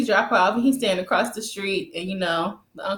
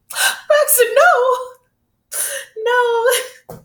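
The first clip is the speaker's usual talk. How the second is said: hammed-up high wail, half laughing; loud mock-sobbing protest, pitched way up; tearful wailing; 12 semitones higher, 3 dB louder, much slower than usual. Fan mourning a TV character's death, hammed-up tearful wail. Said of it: "I'm tearing up".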